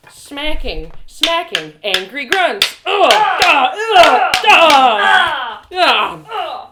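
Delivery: loud, high grunting blows; fight grunts. Acted. Narrator acting out the fight with Trinia, loud grunts. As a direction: grunt with every strike.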